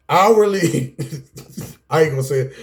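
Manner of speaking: normal talking voice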